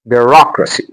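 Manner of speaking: "Bureaucracy" with ROC made prominent; stress on second syllable. Shown as a demonstration. Teacher modelling word stress, "Bureaucracy" stressed bu-ROC-racy.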